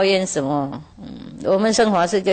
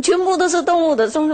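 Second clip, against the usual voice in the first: higher and faster, leaning on 全, ending in a rise; complaining farmer; 6 semitones higher, faster than usual. Retold or imitated. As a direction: rush it out higher, hitting 全. (农夫向师父诉苦，加快语速强调"全")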